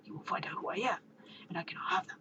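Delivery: hushed, strained disguise voice; Batman. Acted. Masked hero explaining his odd sound, strained whisper. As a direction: whisper hoarsely to hide your identity. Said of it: "you strain your voice so we don't find out".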